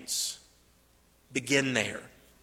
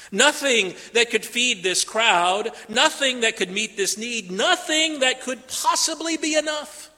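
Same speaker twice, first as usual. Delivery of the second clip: ordinary talk, nothing marked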